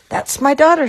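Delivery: high, loud proud exclamation; proudly. A narrator's reading. Mama sees Millie pass by, calling out proudly. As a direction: burst out with a parent's pride. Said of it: "said Mama proudly".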